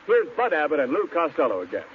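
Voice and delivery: ordinary talk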